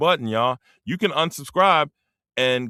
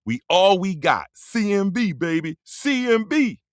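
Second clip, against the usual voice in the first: high-pitched crew chant; rallying cry, pitched way up; hyped shout-out; 6 semitones higher than usual. Real, not acted. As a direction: call out the crew's letters high and proud.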